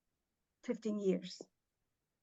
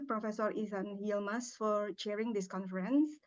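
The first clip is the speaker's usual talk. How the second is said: ordinary talk, nothing marked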